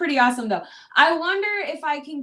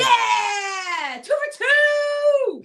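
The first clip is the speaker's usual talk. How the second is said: loud, high celebratory shout; real victory yell; triumphant cheer; 12 semitones higher, 3 dB louder, much slower than usual. Real, not acted. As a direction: whoop it out, stretching the last word. Celebrating a correct quiz answer.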